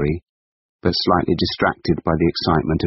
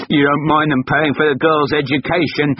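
sarcastic retort; 9 semitones higher than usual. Acted. Husband retorting in quarrel.

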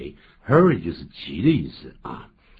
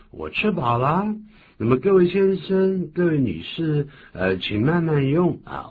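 higher, courteous host address; polite host; 9 semitones higher, 4 dB louder than usual. Acted. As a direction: invite the guests to take their time. Host addressing guests at dinner.

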